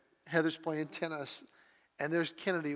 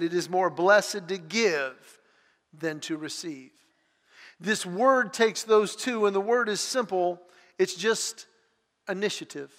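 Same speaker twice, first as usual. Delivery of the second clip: louder and higher, a full-voiced sermon read of scripture; preaching; 3 semitones higher, 8 dB louder than usual. Real, not acted. Pastor reading Hebrews and Acts to the congregation, preaching loud. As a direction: proclaim it to the whole room.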